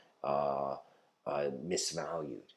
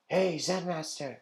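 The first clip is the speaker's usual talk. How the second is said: put-on high, slow call to the old master; lightly voiced eager seeker, drawn out; 10 semitones higher than usual.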